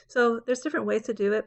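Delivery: ordinary talk, nothing marked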